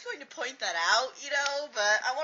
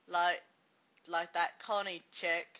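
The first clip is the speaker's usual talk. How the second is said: ordinary talk, nothing marked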